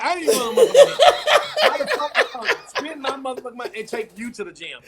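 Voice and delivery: yelling through laughter; loud, laughing outrage; laughing shout. Real, not acted. Laughing rant about the gym.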